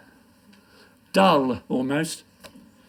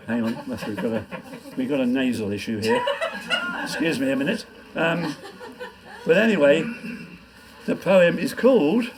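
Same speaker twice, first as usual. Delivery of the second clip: ordinary talk, nothing marked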